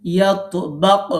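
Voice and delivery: "ইয়াতবাক" slow and loud, the final ক released with an echoing bounce; "ইয়াতবাক" said slowly, ending on a pushed, bouncing ক; qalqala bounce. Shown as a demonstration. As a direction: stretch out "ইয়াতবাক" and bounce the last ক hard. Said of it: "কফে কলকলা করতে হবে".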